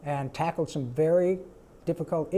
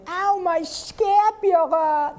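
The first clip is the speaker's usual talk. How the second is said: very high, slow cartoon whine; Carl's scapula line; 12 semitones higher, 5 dB louder, much slower than usual. Carl complaining of his injury, high slow whine. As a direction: drag out "scapula" like a hurt nerd. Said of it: "Like saying".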